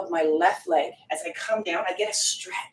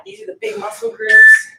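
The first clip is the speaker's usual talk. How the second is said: loud coaching; 11 semitones higher, 11 dB louder than usual. Real, not acted. Coach pushes during hard set.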